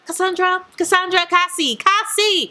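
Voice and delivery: high, sing-song scolding of the author; teasing call. Acted. Booktuber teasingly calls out author Cassandra, high and sing-song. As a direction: call her name like you caught her.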